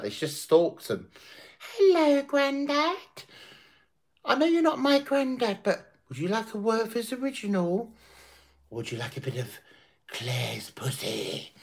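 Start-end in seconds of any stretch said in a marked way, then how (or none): 1.8-7.9 much higher, sugary mock-innocent voice; mock sweet voice. Mocking Kat chatting up a grandad, high sugary voice. Mocking woman luring care home residents. 8.7-11.5 mock sultry. Same mock voice turning suggestive.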